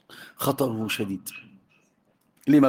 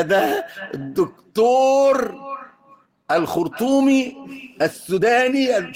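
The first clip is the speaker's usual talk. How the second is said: mock grand title; 7 semitones higher, slower than usual. Mock-grand introduction of student.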